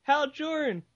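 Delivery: very high drunk cartoon voice. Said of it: "my drunk voice sounds vaguely like a Mario impression".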